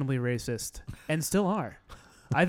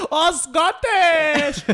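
much higher and louder, slow, breaking into laughter; laughing mock cry; 11 semitones higher, 6 dB louder, much slower than usual. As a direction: howl "Oh, Scottish!" through laughter.

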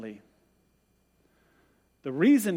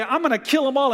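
higher, louder furious vow; angry threat; 8 semitones higher, 5 dB louder, faster than usual. Retold or imitated. God furious at the people, vowing to wipe them out. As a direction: thunder it out in rage. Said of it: "God would be so mad".

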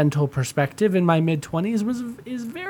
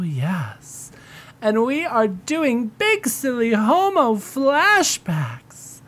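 lightly raised campy diva host voice; femme fatale; 9 semitones higher, slower than usual. Acted. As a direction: purr the greeting like a drag diva. Host greets as drag persona.

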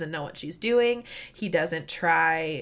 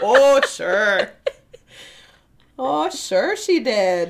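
loud, playfully exaggerated Midwestern accent; hammed-up Midwestern; 4 semitones higher, 8 dB louder, much slower than usual. Co-host mocking her own slip, hammed-up Midwestern. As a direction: ham up the Midwestern vowels, higher and louder. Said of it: "I sounded so Midwestern".

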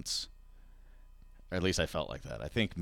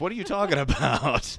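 incredulous laugh, louder; 8 semitones higher, 8 dB louder than usual. Real, not acted. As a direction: laugh through the question. Mocking rude customers.

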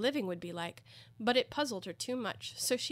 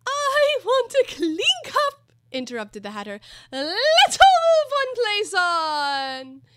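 loud demanding Hatter; 13 semitones higher, 13 dB louder, slower than usual. A narrator's reading.

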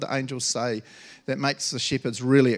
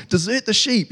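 excited urging; 8 semitones higher, 5 dB louder than usual. Retold or imitated. Shepherds rushing, acted out.

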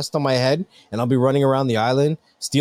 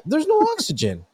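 incredulous; 13 semitones higher than usual. Real, not acted. Repeats in disbelief, pitch jumps.

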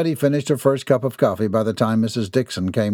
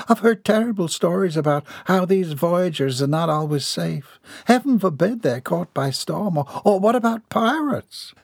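higher, worried Southern lady; fretful lady; 8 semitones higher than usual. Acted. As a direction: fret and fuss over the voyage. Voices lady in story.

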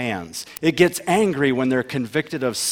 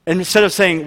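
normal talking voice

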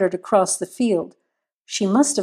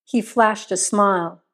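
ordinary delivery

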